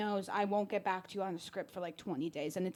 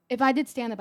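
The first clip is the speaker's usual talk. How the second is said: normal talking voice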